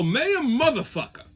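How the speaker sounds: usual speaking voice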